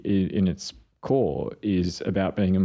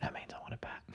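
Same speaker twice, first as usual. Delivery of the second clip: put-on whispered, high child voice; a little girl whispering as a kitten; child's whisper; 5 semitones higher, 19 dB quieter, faster than usual. Daughter pretending to be a kitten, whispering. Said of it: "She'd meow and be like".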